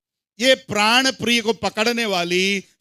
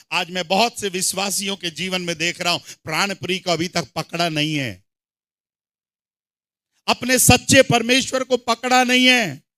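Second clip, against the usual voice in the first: ordinary talk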